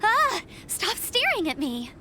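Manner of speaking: playful yelp, then mild protest; mock startled reaction. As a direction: imitate flinching under someone's stare. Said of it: "I'm just like".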